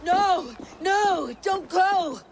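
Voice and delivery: high-pitched desperate shout; pleading yell; desperate cry. Acted. Desperate plea as elk vanishes.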